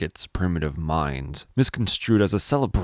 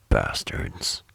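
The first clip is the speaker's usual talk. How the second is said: bitter curse; 8 semitones lower than usual. Acted. Bitter muttered curse alone.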